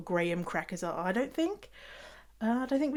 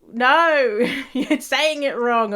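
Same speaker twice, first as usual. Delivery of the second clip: loud, higher, stretched NO; laughing protest; 4 semitones higher, 6 dB louder, slower than usual. Acted. Reacting to words said differently in Canada, laughing protest. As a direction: protest loudly through a laugh.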